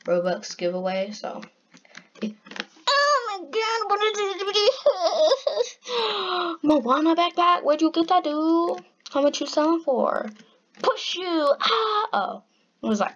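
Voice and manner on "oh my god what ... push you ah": pitched way up, squeaky; high squeaky voice. Real, not acted. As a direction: let the voice jump high and thin. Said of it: "my voice is gonna go high again".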